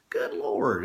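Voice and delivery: normal talking voice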